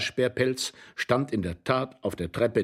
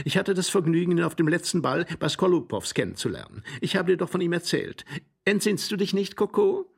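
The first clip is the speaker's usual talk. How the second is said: high, sociable lady's voice; chatty lady; 8 semitones higher than usual. Acted. Glafira introduces an acquaintance to husband Coco, high and chatty. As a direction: introduce him in a high, coaxing lilt.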